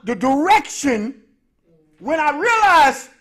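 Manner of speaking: slow, drawn-out, loud preacher build; preaching climax. Acted. Preacher to congregation, slow loud sermon build. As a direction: stretch each phrase like a sermon climax.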